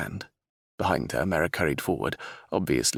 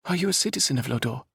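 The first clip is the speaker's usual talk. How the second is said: curious princess; 7 semitones higher, faster than usual. Acted. Princess Isabella voiced in story.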